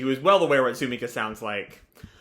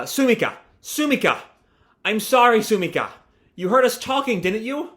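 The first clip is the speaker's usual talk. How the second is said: higher-pitched acted call; calling after someone; 6 semitones higher, 3 dB louder than usual. Michi chasing Sumika to apologise, calling after her.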